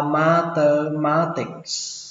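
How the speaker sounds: "mathematics" slowly broken into ma-tơ-ma-tics; syllable by syllable. Shown as a demonstration. Teacher first models the word for learners, slow and syllabified. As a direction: stretch out each syllable of "mathematics".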